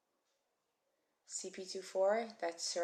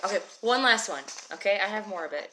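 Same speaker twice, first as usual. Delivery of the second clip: firm announcement; 7 dB louder, faster than usual. Real, not acted. Teacher insisting on last one.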